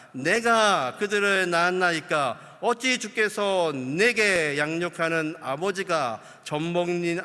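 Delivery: slow, loud, measured Bible reading; solemn scripture reading. Acted. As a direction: read the verses slowly and gravely to the congregation. Reads scripture aloud. (느리고 장중한 성경 봉독)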